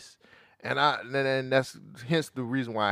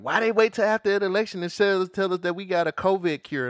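mock complaint; 8 semitones higher, 5 dB louder than usual. Mimics someone complaining about vaccine timing.

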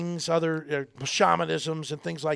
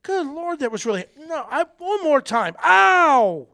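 put-on high disbelieving kid, building to a loud yelp; kid getting burned; 11 semitones higher, 8 dB louder than usual. Kid burning his hand again, high then yelping loud. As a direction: ham up the kid who touches it again, then yells ow. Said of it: "And you go".

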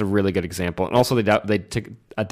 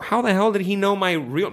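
incredulous; 9 semitones higher than usual. Acted. Voices character's baffled reaction.

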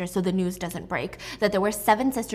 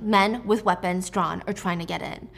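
ordinary delivery

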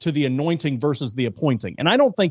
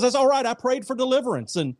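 joke stubborn man; 8 semitones higher than usual. Acted. Joke man refuses, punchline.

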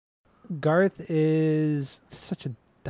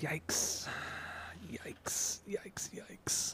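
much softer, breathy whisper of yikes; 17 dB quieter, much slower than usual. Real, not acted. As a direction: hush it out like a shocked aside. Reacting to a harsh opinion.

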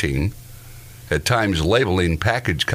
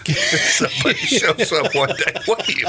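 laughing; 10 semitones higher than usual. Pretend. Mock landlord scene, laughing.

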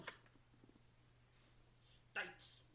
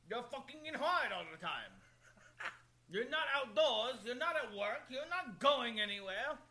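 ranting; 6 semitones higher, 3 dB louder than usual. Real, not acted. Ranting about rodents.

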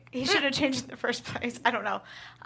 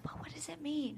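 whispering; 2 semitones higher, 17 dB quieter than usual. Real, not acted. Confused aside among hosts.

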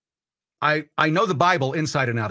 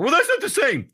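very high, louder mock protest; mock objector; 12 semitones higher, 4 dB louder than usual. Host voicing an objector's comeback about tanning, mock protest. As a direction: squawk the objection in a much higher voice.

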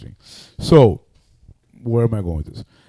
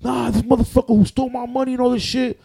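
surprised question; 14 semitones higher, 4 dB louder than usual. Retold or imitated. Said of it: "he's like".

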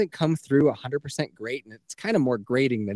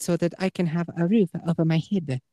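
put-on higher-pitched caricatured Chinese accent; mock Chinese accent; 5 semitones higher than usual. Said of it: "Chinese woman?".

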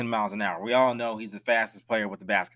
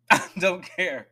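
slow, laughing repeat; 5 semitones higher, slower than usual. Real, not acted. To viewers, a laughing I don't care. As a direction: laugh it out slowly. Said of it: "That's how I feel".

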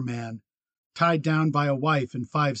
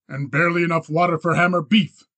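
louder, sharp retort; snapped; 3 semitones higher, 7 dB louder than usual. A narrator's reading. Rancher answers the cattlemen about scarce water, snapped. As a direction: snap back at him forcefully. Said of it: "Miles snapped".

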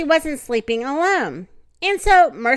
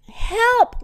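calling for help; 11 semitones higher, 3 dB louder than usual. Acted. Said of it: "Miss Watson called again".

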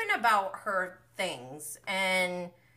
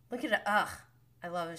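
usual speaking voice

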